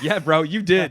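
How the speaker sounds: loud, hammed-up congratulation; hyped-up praise. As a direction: cheer the driver on at full volume. Acted hyped praise to the driver.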